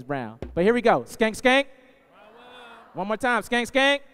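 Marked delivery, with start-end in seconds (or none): 1.0-1.7 high, loud call to the crowd; rousing shout. Acted. Crowd call, building energy. 3.4-4.0 high, loud call to the crowd; rousing shout. Acted. One more time, stronger.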